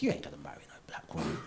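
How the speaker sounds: low, hushed, quick pronouncement; dead certain. Acted. Uncle correcting his five-year-old nephew, hushed certainty. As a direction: say it fast under the breath, utterly sure. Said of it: "he was so sure about it".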